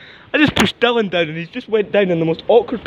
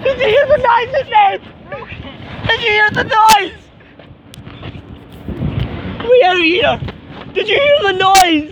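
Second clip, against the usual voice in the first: excited; 12 semitones higher than usual. Real, not acted. Something breaks with a noise.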